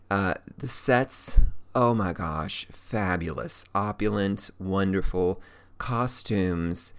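normal talking voice

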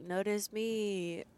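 slow, drawn-out mock plea; imitated show-off call; mock attention-seeking. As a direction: stretch it out, begging to be noticed. Acted plea of man seeking notice.

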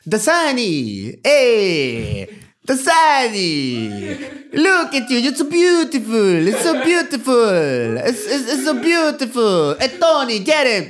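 drawn-out, hammed-up Italian accent; swooping, lingering mock-Italian come-on; Italian flirt accent. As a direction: woo her in a caricature Italian accent. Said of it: "Italian, you're trying to hit on someone".

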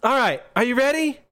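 put-on high, eager child's voice; kid trainer. Young trainer readying for the battle, eager. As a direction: ask it high-pitched, gearing up for battle.

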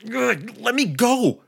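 put-on high, strained boy's cry; a kid yanked by the ear, protesting; boy in pain. A narrator's reading. Boy dragged by his ear.

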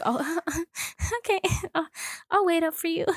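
high, flustered giggle through the words; giggly smitten listener. Acted. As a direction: play shyly won over. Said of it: "You sound, you sound like".

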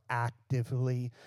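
"actively" leaned on and set apart; stress on actively. Real, not acted. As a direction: stress the word "actively" deliberately. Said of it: "I stress the word active".

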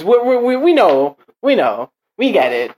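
high, loud start settling down; mock exasperated concession. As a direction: concede it over and over, hammed-up. Acting out Germans conceding, fed up.

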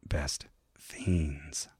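breathy low whisper of the name. Acted. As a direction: whisper it like a secret. Whispered echo of the sultry ad.

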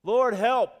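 high, loud cry for help. Acted. Sinking Peter cries out to Jesus, high desperate shout. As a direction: yell for rescue as you go under. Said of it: "Peter cried out to Jesus".

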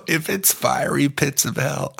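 ordinary talk

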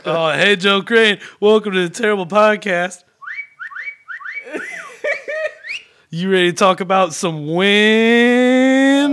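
booming talk-show host welcome; loud show host. Acted. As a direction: announce it like a cheesy podcast host. Host greeting guest loudly.

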